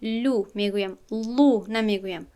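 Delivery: ordinary talk, nothing marked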